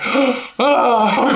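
exaggerated gasp and high, delighted "ah"; mock awed delight. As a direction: gasp like a thrilled kid in a candy store. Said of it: "just like a kid in a fucking candy store".

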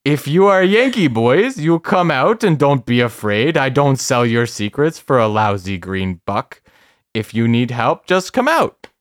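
loud mock Polish accent drifting into French Canadian; terrible Polish accent. Storyteller quoting the man coaxing Yankee boys out, bad Polish accent. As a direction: call out in a hammed-up, badly faked Polish accent. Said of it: "your terrible Polish accent".

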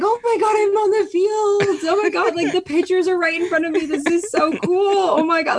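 high, gushing fan voice; super excited. Retold or imitated. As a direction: reenact being starstruck and thrilled. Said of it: "like, super excited".

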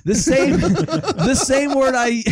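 slow, high, laughing through it. Real, not acted. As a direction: giggle the words out.

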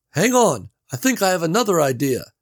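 Gary calling through the wall; Gary's voice. A narrator's reading.

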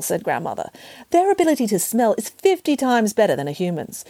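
ordinary delivery, nothing marked

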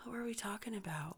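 hushed, baffled question; lost whisper. Real, not acted. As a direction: whisper it, having lost the thread. Lost the story thread.

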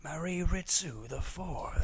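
slow trailer announcer; movie trailer voice. Pretend. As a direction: intone it slowly, trailer style. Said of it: "nineties movie trailer voice".

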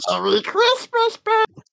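put-on voice. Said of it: "I gotta, like, warm up".

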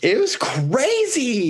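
very high squeal of delight; squealing excited. Real, not acted. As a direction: squeal it out, thrilled. Squealing reaction.

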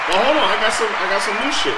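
high, loud and hyped up; bursting to show off the new toy; excited. Real, not acted. Showing off new sound effects.

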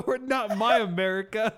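loud, high indignant shout; hammed-up patriotic fury; mock outrage. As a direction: shout it through a laugh. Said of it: "He just gets pissed".

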